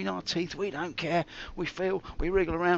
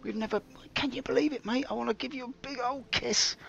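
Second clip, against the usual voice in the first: excited; 9 semitones higher than usual. Real, not acted. Gushing to a viewer.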